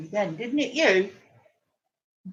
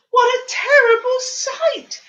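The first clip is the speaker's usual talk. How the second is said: mock horror; 13 semitones higher, 9 dB louder than usual. Gasp at the picture.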